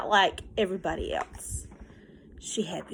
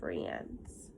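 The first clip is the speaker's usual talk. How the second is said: usual speaking voice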